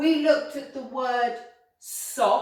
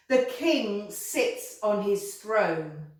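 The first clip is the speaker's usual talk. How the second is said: normal talking voice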